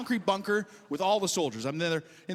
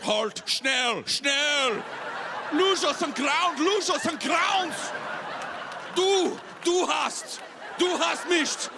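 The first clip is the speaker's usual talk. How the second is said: [name]; 9 semitones higher, much slower than usual. Pretend. German rocket attack announcement scaring everybody, slow fierce mock shouting. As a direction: draw out each German word in a hammed-up scary shout. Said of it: "if [name] announced the rocket attack".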